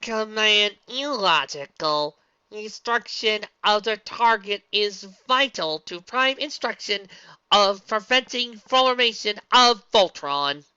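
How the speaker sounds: slow, high mechanical voice; robot voice. Acted. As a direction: speak like a machine. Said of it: "A mechanical voice replied".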